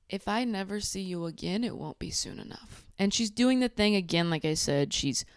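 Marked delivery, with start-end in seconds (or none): 0.1-2.9 hushed, much softer, fading to a whisper; mock whispered jab. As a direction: whisper it as a secret barb. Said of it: "And she's saying".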